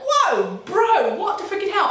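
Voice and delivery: high-pitched retold shock; shocked disbelief. Overhearing the row from upstairs, shocked outburst. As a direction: react stunned, pitch leaping up.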